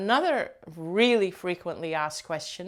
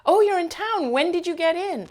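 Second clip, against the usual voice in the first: much higher, louder gushing hello, imitating a stranger; imitating an excited greeting; 11 semitones higher, 5 dB louder than usual. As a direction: gush like greeting an old friend. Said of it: "she said something more like".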